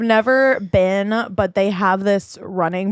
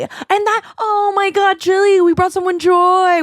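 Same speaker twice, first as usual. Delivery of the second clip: giddy gush; 10 semitones higher, 3 dB louder than usual. Real, not acted. Gushing about helping someone.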